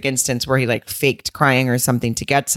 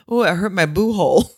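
mock whiny complaint, pitched up; quoting him; 4 semitones higher than usual. Friend retelling her husband's complaint, mock whiny. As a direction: whine it like a hurt grown man. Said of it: "he was like".

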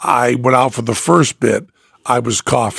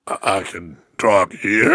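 low broken-register take, a bit softer; 6 semitones lower, 4 dB quieter than usual. Retold or imitated. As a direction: drop into the low cracked register. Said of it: "So I was going".

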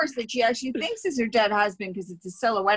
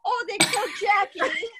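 high shrill mock cry, way up in pitch; overdone soap-opera grief; hammed-up grieving widow; 12 semitones higher than usual. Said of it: "she does this instead".

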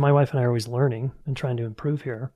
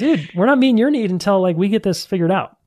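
higher, louder retold brush-off; hammed-up fed-up dad; mock exasperated parent; 6 semitones higher, 4 dB louder than usual. Dad telling his son to wait, mock exasperated.

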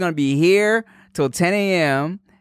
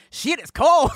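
much higher startled complaint; mock shock; 12 semitones higher, slower than usual. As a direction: yelp at the sudden cold. Retold reaction to cold AC.

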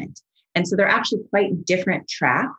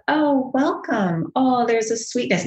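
tender self-kindness; 5 semitones higher, slower than usual. Retold or imitated. Kind voice to oneself.

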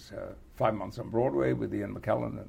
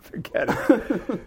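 laughing; 10 semitones higher, 5 dB louder, slower than usual. Retold or imitated. Retelling crew's laughing verdict.

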